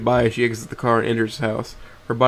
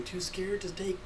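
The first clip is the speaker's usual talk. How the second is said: slow, higher, hushed sneer; smug taunt; 8 semitones higher, 15 dB quieter than usual. Acted. Nazar mocks him from the window, slow hushed sneer. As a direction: needle him softly and slowly. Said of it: "with a laugh and flashes a smug smirk".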